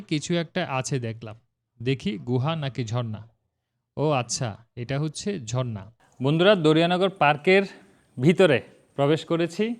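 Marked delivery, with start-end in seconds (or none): 6.2-9.7 echo. Real, not acted. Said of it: "কথা অনেকটা ইকো হচ্ছে".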